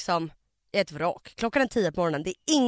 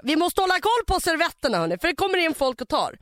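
high, louder mock-polite pleading; sarcastic mock question; 6 semitones higher, 4 dB louder than usual. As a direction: needle her with fake politeness. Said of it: "jag bara". (hånfullt överdrivet artig fråga)

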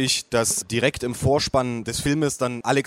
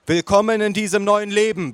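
high, raised-voice proclamation; bellowing waitress; 7 semitones higher, 4 dB louder than usual. Acted. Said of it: "brüllte die Kellnerin".

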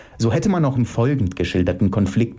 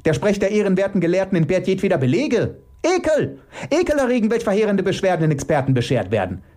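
high, agitated rant at speed; 9 semitones higher, faster than usual. Acted. Elke denouncing the scholars' claims, agitated. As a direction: rattle off an indignant high-pitched tirade. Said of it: "entgegnete Elke erregt".

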